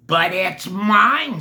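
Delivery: loud, slow, indignant cry; loud slow indignant bird; scream. Acted. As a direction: demand it back, loud. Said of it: "screamed the bird".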